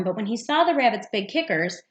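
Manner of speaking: usual speaking voice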